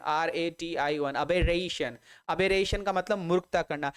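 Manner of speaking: normal talking voice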